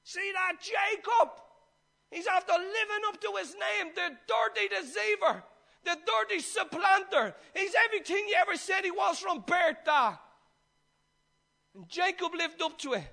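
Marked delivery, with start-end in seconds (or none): 0.0-10.2 high, loud wail of outrage; bitter outcry. Acted. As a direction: cry out against your brother, acting bitterly betrayed. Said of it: "he cried and wept bitterly".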